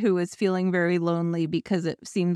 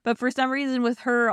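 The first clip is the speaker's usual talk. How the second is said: ordinary talk, nothing marked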